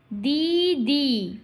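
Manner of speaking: very slow, long held vowels; broken into syllables. Shown as a demonstration. Teacher breaking verse into beats, slow-motion syllables. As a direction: stretch both syllables right out. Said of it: "প্রথমে ভেঙে নেবে".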